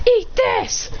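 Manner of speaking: high-pitched battle cry; fighting taunt. Acted. Fighter about to fire at opponents, high-pitched taunt. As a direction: call it out high before the attack.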